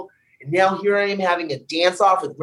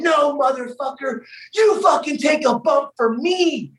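angry retort; 7 semitones higher than usual. Retold or imitated. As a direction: fire it back like you're seeing red. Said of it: "I'm just getting so red".

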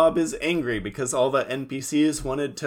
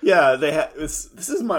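ordinary delivery

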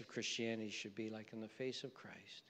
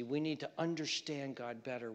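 ordinary talk

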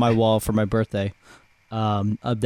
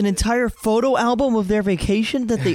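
high-pitched, scandalized disbelief; mock indignation; 11 semitones higher than usual. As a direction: play someone hurt at being left out. Acting out outrage at left-out vacation.

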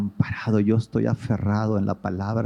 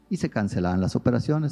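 normal talking voice

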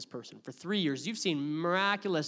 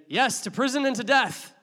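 bold proclamation; 8 semitones higher, 9 dB louder than usual. Acted. Peter's bold answer he didn't give.

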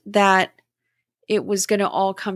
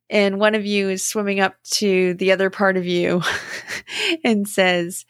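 ordinary talk

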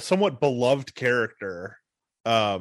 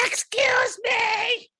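very high, long drawn-out shriek; screamed catchphrase impression; much slower than usual. Explaining what she did on TV, mimicking her slow, high scream. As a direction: imitate a shrieking TV heel, stretching it out. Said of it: "just literally screamed into the mic".

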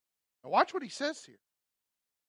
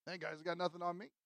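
low, hushed aside of an imagined racer; muttered boast; 4 semitones lower, 14 dB quieter than usual. Acted. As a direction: toss off the boast under your breath. Imagined racer passing a rival.

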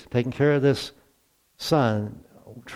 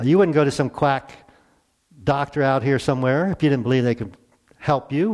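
ordinary talk

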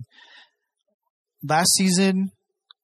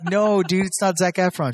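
exasperated correction; 6 semitones higher than usual. Retold or imitated. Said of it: "I'm like".

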